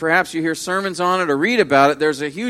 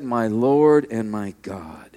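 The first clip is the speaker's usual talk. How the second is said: awed confession; 8 semitones lower, slower than usual. Retold or imitated. Thomas's true confession.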